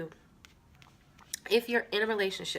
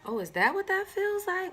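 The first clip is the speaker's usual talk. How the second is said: wondering; 5 semitones higher than usual. Pretend. Imagined wonder of being loved.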